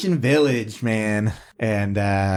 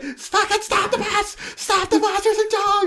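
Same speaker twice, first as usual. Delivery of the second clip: much higher, laughing shriek to stop the bus; frantic kids yelling. Retold or imitated. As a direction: scream at the driver, half laughing. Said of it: "we're all like".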